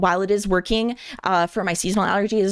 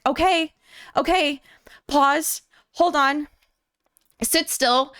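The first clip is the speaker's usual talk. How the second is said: panicked stalling; 6 semitones higher, slower than usual. Retold or imitated. Panicking patient stops the nurse.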